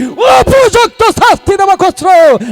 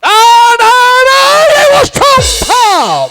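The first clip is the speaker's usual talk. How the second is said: shocked denial; 4 semitones higher, much slower than usual. Acted. King shocked by daughter news.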